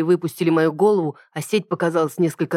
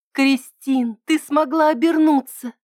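much higher excited cry; astonished and delighted; amazed; 9 semitones higher, slower than usual. A narrator's reading. Ida amazed at transformation.

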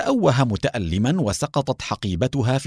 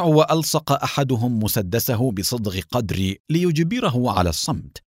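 usual speaking voice

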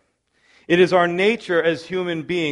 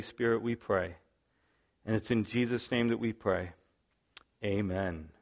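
prayer; 3 semitones lower than usual. Real, not acted. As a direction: pray it low and sincere. Pastor leads opening prayer.

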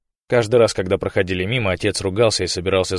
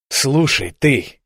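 losing patience; 6 semitones higher, 5 dB louder, much slower than usual. A narrator's reading. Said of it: "Ренат потерял терпение".

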